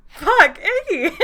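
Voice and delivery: high, loud startled curse; startled outburst. A narrator's reading. She jumps back on seeing her friend, startled. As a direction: jump out of your skin and yell.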